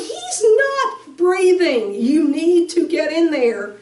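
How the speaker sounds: high, loud, slow desperate insistence; loud, high, slow cry that he's not breathing; desperate shout. Retold or imitated. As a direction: shout it at the paramedics. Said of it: "I was not a hysterical mom".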